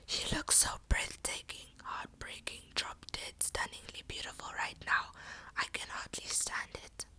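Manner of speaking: intense, fully whispered murmur; whispering. A narrator's reading. Nash behind her praising her looks, whispering. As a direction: whisper it into someone's ear. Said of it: "whispering in your ear".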